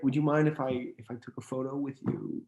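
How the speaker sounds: soft, courteous imitation of a stranger; very polite. Man on subway asking actor for photo, very polite. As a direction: ask gently, as a polite stranger would. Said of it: "very polite, very nice".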